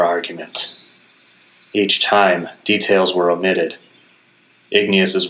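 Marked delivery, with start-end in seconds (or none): none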